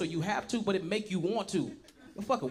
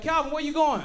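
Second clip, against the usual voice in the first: high, raised street holler; calling out; 9 semitones higher, 6 dB louder than usual. Retold or imitated. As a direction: act out hailing someone down the street. Bystander calls to him in street.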